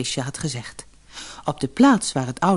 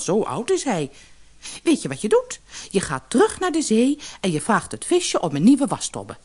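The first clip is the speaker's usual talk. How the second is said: high-pitched nagging tirade; cross, exasperated wife laying down orders; angry wife; 7 semitones higher than usual. A narrator's reading. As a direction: scold him with swooping pitch. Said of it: "riep de vrouw boos uit".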